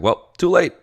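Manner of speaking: high, loud mock shrug of a line; tossing it off as already too late; resigned quip. To herself on seeing the update, a high loud resigned quip. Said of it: "I was like".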